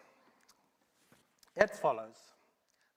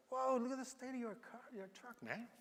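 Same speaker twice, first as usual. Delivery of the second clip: high-pitched astonished whoa; amazed; 13 semitones higher than usual. Acted. Salesman marvelling at the battered truck, high and amazed. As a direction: gasp it out, way up high, impressed.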